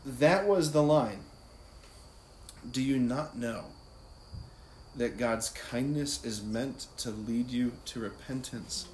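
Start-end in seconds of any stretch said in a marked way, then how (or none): 2.7-8.9 slow, measured scripture reading with long pauses; prayerful, slow. Shown as a demonstration. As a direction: repeat it prayerfully, letting each phrase rest. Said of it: "prayerfully, slowly, just repeat it".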